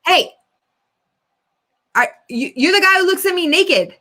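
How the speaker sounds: mock accusation, halting then building; confrontational neighbor. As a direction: pretend to confront someone in the street. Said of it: "I'm gonna be the man and I'm gonna confront you".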